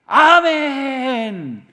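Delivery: a hammed-up believer's amen; drawn-out amen. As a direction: stretch it out in glad agreement. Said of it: "나에게 좋은 일이 벌어질 때".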